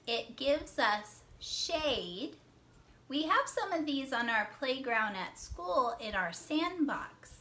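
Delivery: ordinary delivery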